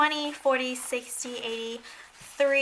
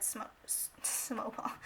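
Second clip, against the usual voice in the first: stumbled whisper; 2 semitones lower, 14 dB quieter than usual. Real, not acted. Vlogger catching her own slip while adding numbers, whispered. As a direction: whisper "snowball" under the breath after the stumble.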